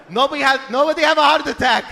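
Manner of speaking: mock irate father; dad's indignant denial. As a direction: send the paramedics away, insisting. Dad angrily turning away paramedics.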